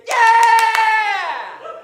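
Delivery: long, high, very loud holler; exuberant shout. Retold or imitated. Comedian re-enacts his reply to people on the street, exuberant shout. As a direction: belt out a drawn-out, high-pitched cheer.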